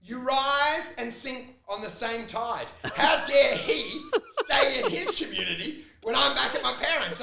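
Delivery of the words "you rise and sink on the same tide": ordinary talk, nothing marked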